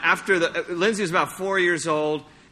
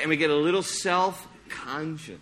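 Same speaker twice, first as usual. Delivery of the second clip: ordinary talk, nothing marked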